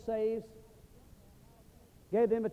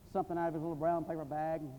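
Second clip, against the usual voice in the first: ordinary talk, nothing marked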